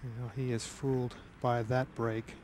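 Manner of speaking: usual speaking voice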